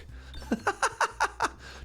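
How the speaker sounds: airy high-pitched giggle; embarrassed giggle at a failed pronunciation; high breathy laugh. Real, not acted. Laughing at own mispronunciation.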